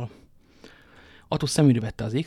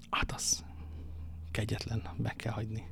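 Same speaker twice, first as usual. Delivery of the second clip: very soft, half-whispered reply; hushed musing; 2 semitones lower, 12 dB quieter than usual. A narrator's reading. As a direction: say it quietly, almost to yourself. Narrated reply voiced very softly.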